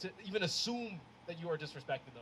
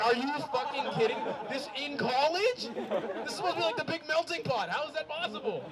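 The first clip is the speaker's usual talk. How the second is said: exasperated; 9 semitones higher, 4 dB louder than usual. Retold or imitated. Outraged retold reaction.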